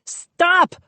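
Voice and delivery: very high shrieked command; high-pitched frightened cry. A narrator's reading. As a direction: shriek it in panic. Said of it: "I shrieked".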